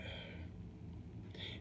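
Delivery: a long, breathy sigh of letdown; deflated sigh over the wrong food; long weary sigh. Retold or imitated. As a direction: sigh out the disappointment. Biting a patty with chicken.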